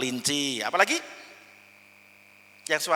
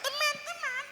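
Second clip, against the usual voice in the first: small voice; 8 dB quieter than usual. Shown as a demonstration. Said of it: "yuk bareng setelah saya".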